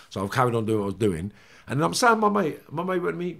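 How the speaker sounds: usual speaking voice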